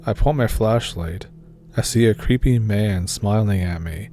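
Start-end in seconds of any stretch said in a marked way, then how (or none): none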